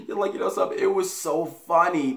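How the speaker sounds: high giggly laughing words. Real, not acted. Laughing while retelling.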